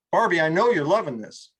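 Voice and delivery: ordinary talk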